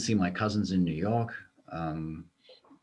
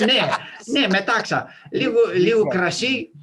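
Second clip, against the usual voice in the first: higher, louder, slow mock recommendation; mock old Greek; 11 semitones higher, 7 dB louder, slower than usual. Joking about old wives' cures, higher and louder. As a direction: savor the remedy like an old Greek.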